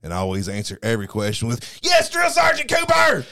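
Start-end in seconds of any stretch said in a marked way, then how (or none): 1.8-3.3 recruit shouting. Acted. Recruit answering drill sergeant.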